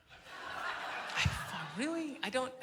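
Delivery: quiet, unimpressed really rising high; a deflated, doubtful really; exasperated really. Retold or imitated. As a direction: nurse doubting him a second time. Nurse doubting him again.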